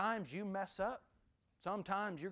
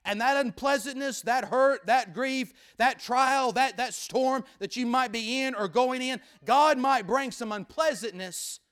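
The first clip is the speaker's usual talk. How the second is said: rising sermon cadence, louder; preaching; 6 semitones higher, 11 dB louder than usual. Acted. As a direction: preach it with building force.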